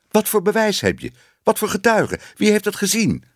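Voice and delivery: very high, insistent questioning; indignant interrogation. A narrator's reading. Boss demanding proof from Tibbe, indignant. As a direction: fire off the questions in a high, exasperated voice.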